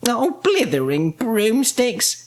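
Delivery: high, slow witch's oath; shouted exasperation. A narrator's reading. Winnie has lost her rocket, high exasperated cry. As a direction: exclaim it high and drawn out. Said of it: "shouted Winnie".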